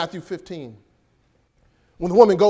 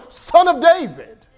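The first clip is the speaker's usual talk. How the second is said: slow, high, louder cry of Son of David; shouting out; 7 semitones higher, 5 dB louder, slower than usual. Acted. Preacher voicing Bartimaeus calling to Jesus, raised and slow. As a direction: call it out like a plea. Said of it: "he shouts it out".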